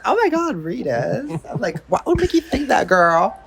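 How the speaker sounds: high-pitched, playful fake shock; mock indignation. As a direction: act scandalized at being read, hammed up. Mock reaction to being read.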